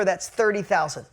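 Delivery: ordinary delivery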